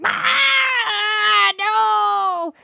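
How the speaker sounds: shrill high terrified shriek; a tiny crop howling in panic; plant screaming. Pretend. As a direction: scream like a plant torn from the ground. Yanked-up crop screams in fear.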